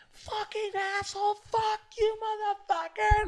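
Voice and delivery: very high drawn-out mock curse; squealing falsetto impression of rage; imitated angry girl. Girl cursing him whenever he passes, mocking falsetto. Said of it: "she's like".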